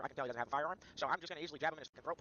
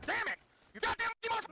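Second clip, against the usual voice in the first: high yelled curse; angry shout; 5 dB louder than usual. Real, not acted. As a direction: blurt it out in a rage. Angry outburst in game chaos.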